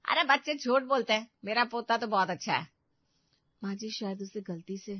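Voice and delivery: usual speaking voice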